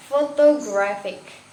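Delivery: "photographic" stressed on GRAPH, slow and deliberate; stress on third syllable. Shown as a demonstration. Teacher demonstrating word stress, "photographic" stressed on third syllable. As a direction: stretch out "photographic", leaning on GRAPH. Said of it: "on the third".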